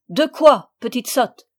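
loud, sharp retort, easing off; cross scolding. A narrator's reading. Stepmother challenges wailing Sophie, cross and scolding. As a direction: snap at a silly child.

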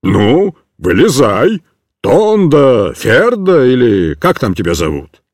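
deep, firm command; gruff sergeant call. A narrator's reading. Sergeant ordering the boy out of the sack, deep. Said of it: "снова крикнул".

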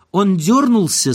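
ordinary delivery, nothing marked

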